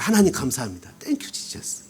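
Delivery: quiet grateful aside fading to a breathless whisper; whispered thanks. Real, not acted. Pastor thanking God quietly, whispering relief. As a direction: murmur thanks to God under your breath. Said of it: "그때 제 마음이".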